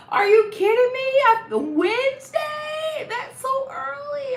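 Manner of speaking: high, drawn-out squeal of disbelief; incredulous outburst. Real, not acted. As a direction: shriek it in disbelief, stretching the words. Mock shock at early Wednesday return.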